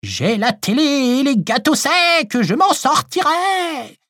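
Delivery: high, slow ghostly croak; horrible hoarse voice. Acted. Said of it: "de son horrible voix rauque".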